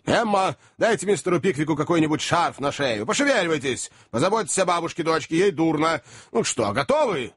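high, hurried commanding voice; urgent orders. A narrator's reading. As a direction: call out instructions to the household. Host barks hurried orders while leaving.

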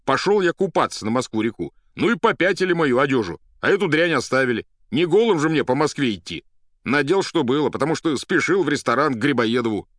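loud, high aggrieved account; indignant angry poet. Acted. Patient explains his stolen clothes to doctor, indignant. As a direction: pour out the grievance at full voice. Ivan tells his grievance.

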